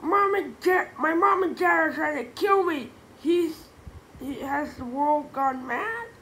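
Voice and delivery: put-on high, loud frantic kid; panicked. A narrator's reading. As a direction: blurt it out in a total panic. Kid panics about parents.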